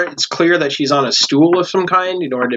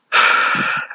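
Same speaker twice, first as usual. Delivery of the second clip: sigh. Acted. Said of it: "and she goes".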